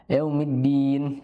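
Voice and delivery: "Yaumiddin" slow, stressed held d; slow firm "Yaumiddin"; held dal. Shown as a demonstration. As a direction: press and hold the d. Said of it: "yaumid ditahan".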